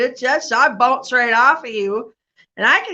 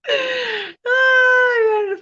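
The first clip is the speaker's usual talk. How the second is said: laughing cry; 12 semitones higher, much slower than usual. Real, not acted. Laughing over falling at first base.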